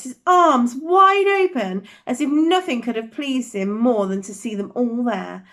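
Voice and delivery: plain reading voice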